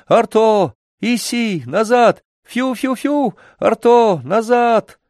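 high, urgent shouts after a runaway dog; anxious loud calling. A narrator's reading. Boy calling his lost dog across the stream, frightened shouting. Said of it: "кричал громко, с беспокойством и испугом".

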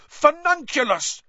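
high, loud yelled curse; yelled spell. A narrator's reading. Harry firing a curse at Malfoy, yelled high and loud. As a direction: hurl the spell at him. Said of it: "Harry yelled".

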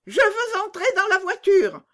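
high, loud bratty yell; demanding child shout. A narrator's reading. Robber girl orders the bandits, high loud bratty shout. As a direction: stamp your foot and demand it. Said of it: "cria la petite fille".

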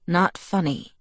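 usual speaking voice

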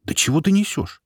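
high indignant outburst. A narrator's reading. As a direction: protest sharply, taken aback. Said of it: "возмутился я".

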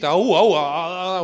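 loud, drawn-out hollering after someone; acted shout, stretched into a long wail; calling out. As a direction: imitate calling to someone running away. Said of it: "Он тебе кричит".

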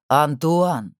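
abrupt, forceful call to stop him talking; sudden cutting exclamation; sharp interruption. A narrator's reading. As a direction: cut in sharply on the name. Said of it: "резко перебил его Харди".

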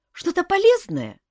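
put-on perked-up boy. A narrator's reading. Young prince hoping for a useful task, excited. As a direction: pipe up keenly as a boy. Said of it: "Мальчишка оживился".

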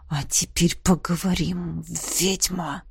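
low, slow, menacing murmur; dragon's low whisper. A narrator's reading. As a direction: breathe it low and close. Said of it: "прошептал". (низкий угрожающий шёпот)

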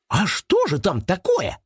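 high, astonished rabbit question; amazed question. A narrator's reading. Rabbit puzzled by the suitcase's contents, amazed. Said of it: "изумился Крош".

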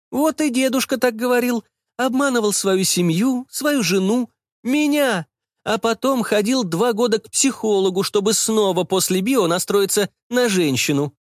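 high-pitched complaining mother; aggrieved mother. Acted. Mother complaining to son about his grandfather, aggrieved. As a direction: voice a mother airing an old grievance, pitched high.

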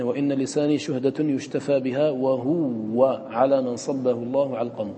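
slow verse recital, وهو said with a doubled waw as wahuwwa. Shown as a demonstration. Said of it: "بتشديد الواو".

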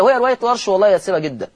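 ordinary delivery

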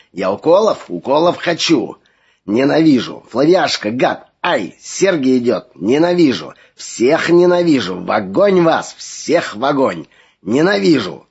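loud, hateful, high howl; hateful possessed shrieking. Acted. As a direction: howl with hatred at everyone. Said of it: "чужой голос надрывно кричал".

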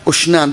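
"أشنان" starting with a short u, "ushnan"; ضمة على الهمزة. Shown as a demonstration. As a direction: open "أشنان" on an u vowel. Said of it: "بالضم عندكم". (نطق "أشنان" بضم الهمزة)

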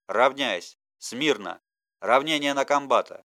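drawn-out parade-ground roar, loud and high; shouting drill sergeant. Acted. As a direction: bark the commands across the camp. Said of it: "растянутый рык". (строевой рык сержанта на плацу)

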